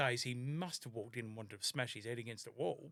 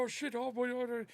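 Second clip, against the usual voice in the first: mock panic; 10 semitones higher than usual. Panicked kid after car crash.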